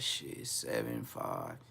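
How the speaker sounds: low muttered haggling. Retold or imitated. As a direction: mumble the numbers under breath. Hesitant bargaining mutter.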